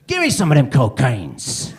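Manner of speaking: slow, mock-eager shout; loud demand. Comedian won over by guest's confidence, loud mock demand. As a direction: belt it out slowly as a comic demand.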